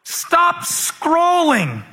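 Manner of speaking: shouting stop at the phone scrollers; yelled stop. Retold or imitated. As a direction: yell it at the top of your lungs. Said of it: "I yelled at the top of my lungs".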